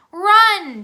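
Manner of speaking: sudden loud, high shout; yelling. A narrator's reading. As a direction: yell to make them flee. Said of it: "Dink yelled".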